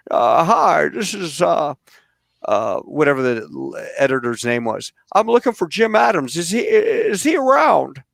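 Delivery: a hammed-up Jimmy Stewart prank call. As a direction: play the aw-shucks Jimmy Stewart on the phone. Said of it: "I put on my Jimmy Stewart voice".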